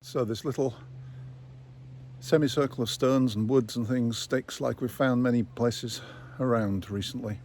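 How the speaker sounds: panting after climbing a hill; out of breath. Real, not acted. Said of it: "Sorry, I'm out of breath".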